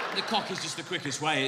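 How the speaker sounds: usual speaking voice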